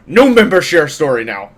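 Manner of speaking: loud, lightly voiced brute guard; guard making announcement. Guard ordering the group to share a story, loud. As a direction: announce it in curt broken speech. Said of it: "clears his throat and say".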